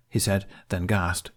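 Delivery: ordinary delivery, nothing marked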